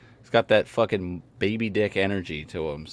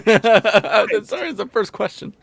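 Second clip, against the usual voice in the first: laughing apology; 11 semitones higher, 4 dB louder, slower than usual. Real, not acted. Scolded mid-story, laughs apologetically.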